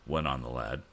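ordinary delivery

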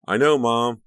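put-on high, loud exasperated teen retort; irritated yell back at mom; angry shout. A narrator's reading. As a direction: shout it down the stairs, annoyed. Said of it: "Becca shouted down angrily".

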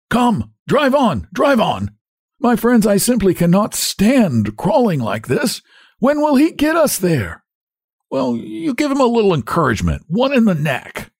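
very high, cracked, strained nagging; high-pitched peevish urging; impatient hunchback. A narrator's reading. Hunchback impatiently bullies the driver.

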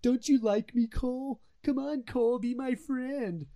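needy begging impression. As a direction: beg like a pathetic clingy friend, slowing down. Mimics Zeke begging for friendship.

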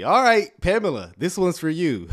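loud, high hype call to a viewer; big radio-style dedication; fired-up shout to Pamela in the chat; excited shout-out. Real, not acted.

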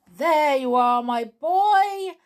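loud, high, jovial captain voice; hearty captain. Acted. Captain handing Jolly the key, loud and jovial. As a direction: boom it out proudly like a kindly captain. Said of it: "said the captain".

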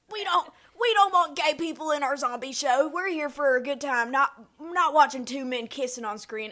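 loud, high whiny bigot voice; mocking conservative. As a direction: parody an indignant prude. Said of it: "conservative people being like".

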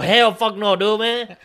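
loud, high-pitched refusal; indignant denial. Retold or imitated. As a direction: push back hard against the accusation.

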